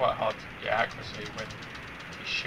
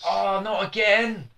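exasperated shout; 10 semitones higher, 7 dB louder, slower than usual. Real, not acted. Game ends, loud dismay.

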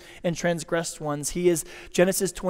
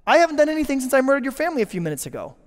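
higher, fast, put-upon protest; mock offended; 6 semitones higher, faster than usual. Murderer acting offended at the victim's refusal, fast whiny protest. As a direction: play the wounded innocent. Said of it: "And you're like".

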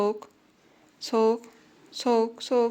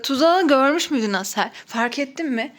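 ordinary talk, nothing marked

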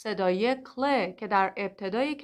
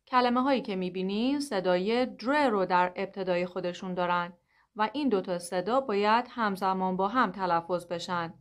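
normal talking voice

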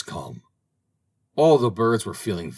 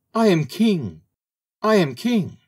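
very high, small bird's cry; 10 semitones higher, 4 dB louder than usual. A narrator's reading. Little bird crying out of a mouse hole, small high voice. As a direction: pipe it up in a tiny, high voice. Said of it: "with his small voice".

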